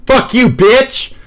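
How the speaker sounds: very high, loud slow yell; shouted insult. Pretend. Translating cheers mate as a screamed insult. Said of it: "That's me saying".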